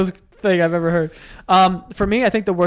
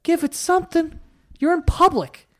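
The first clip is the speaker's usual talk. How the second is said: mock scolding; 10 semitones higher than usual. Mock scolding the baby's mother.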